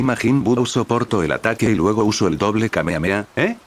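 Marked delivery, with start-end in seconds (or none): 3.4-3.6 short, high rising query; startled little "eh?", pitched up; a quick questioning blip of surprise; surprised. A narrator's reading. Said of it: "dijo sorprendida".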